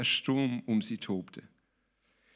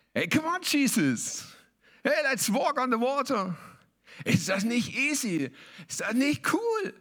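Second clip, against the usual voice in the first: high, show-off buddy voice, much higher; swaggering disciple, pitched way up; cocky Petrus; 11 semitones higher than usual. Retold or imitated. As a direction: play Peter as the cool guy inviting Jesus. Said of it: "so ganz lässig".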